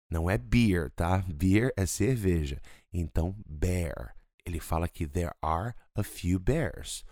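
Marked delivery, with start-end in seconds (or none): none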